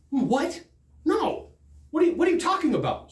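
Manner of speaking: high-pitched retold disbelief; high incredulous protest, quickening at the end. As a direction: object in disbelief, pitch jumping up. Retold disbelief at salesman taking call.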